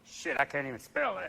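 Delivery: low, soft aside; imitating a baffled president under the breath; George W. Bush. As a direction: mumble a defeated aside. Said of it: "there's poor W going".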